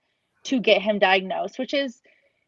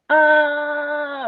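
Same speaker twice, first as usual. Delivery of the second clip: a short, higher, louder "ah" imitating a child's stim; a mild mock vocal stim; 6 semitones higher, 4 dB louder than usual. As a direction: mimic the child's repeated vocal sound. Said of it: "he's constantly going".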